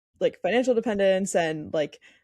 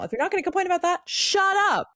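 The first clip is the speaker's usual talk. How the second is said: exasperated rant; 11 semitones higher than usual. Real, not acted. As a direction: snap it at the whiners, pitch climbing. Ranting about accent complainers.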